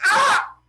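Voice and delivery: high, loud cry of shock; acted shriek at a sudden sight; mock horrified scream. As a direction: scream in storybook horror. Athena sees the hanging girl.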